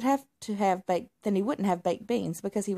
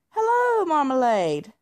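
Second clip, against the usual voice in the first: much higher, louder cheerful greeting; tomcat shopkeeper; 13 semitones higher, 5 dB louder than usual. Acted. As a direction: shopkeeper calling out hello. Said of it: "Roger asked".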